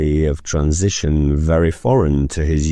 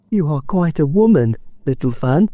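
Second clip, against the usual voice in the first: higher, lightly voiced admiring boy; boy exclaiming; 10 semitones higher than usual. Schoolboy admiring his sister Fan, lightly voiced. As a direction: marvel at your little sister. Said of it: "exclaimed the boy".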